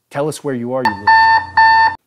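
screamed, rising into a shriek, slowing at the end; an executive loses it and screams the threat down the phone; furious yell. Acted. Executive yells threat over phone.

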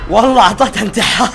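words breaking into a high laugh. Real, not acted. Player laughs at what he did to the giant. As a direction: crack up at the giant. Laughing at his own move.

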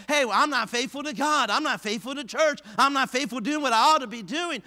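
high, loud preacher's admission; emphatic confession. Acted. Preacher voicing a discouraged churchgoer's admission, emphatic confession. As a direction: drive home each failing in parallel beats.

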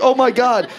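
loud, high burst of alarm; horrified exclamation. Acted. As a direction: blurt it out in mock horror. Horrified at the dog's tongue.

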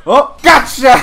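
high, loud pounce; triumphant shout. Real, not acted. Gamer catching an opponent, triumphant shout. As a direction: crow as the catch lands.

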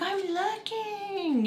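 high, slow drawn-out whine; whiny teen. Retold or imitated. Girl whining back at her mom while searching. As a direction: stretch it out like a sulky teen. Girl's sulky answer.

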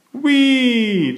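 high, loud long whee; children calling whee. A narrator's reading. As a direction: call out on the ride. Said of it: "they call".

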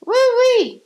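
high, loud whooping cheer; excited cheer. Real, not acted. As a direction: whoop it up for the new year. Cheering at New Year party.

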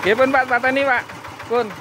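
high, loud call over the engine; shouted request. Real, not acted. Mechanic asking the owner to switch off, shouted over engine noise.

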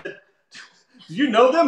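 high, slow, drawn-out question; mock cab driver. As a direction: imitate a driver asking doubtfully, stretched out.

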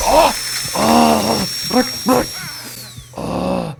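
high, loud cries of pain; pained yell. Real, not acted. Player grabbed by an enemy, yelling in pain. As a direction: yelp as if grabbed.

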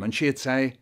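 plain reading voice

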